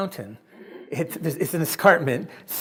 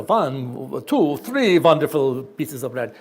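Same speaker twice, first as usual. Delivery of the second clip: Transylvanian vampire count counting; 2 semitones lower, 6 dB louder, slower than usual. Retold or imitated. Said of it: "He speaks with a Transylvanian accent".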